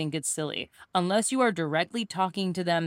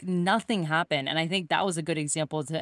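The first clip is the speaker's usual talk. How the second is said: ordinary talk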